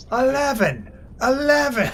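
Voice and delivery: hammed-up Scottish yell quoting the sketch for friends; mock Scottish shout. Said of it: "that's that from that Scottish YouTube sketch".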